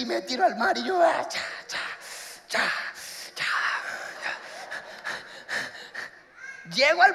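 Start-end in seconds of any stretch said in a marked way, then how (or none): 1.0-4.4 breathless panting while swimming; gasping effortful swim sounds; swimming gasps. Retold or imitated. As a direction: pant out each stroke.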